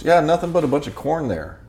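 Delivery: lightly mock her dismissive reply; a hint of a know-it-all waitress; imitating a waitress.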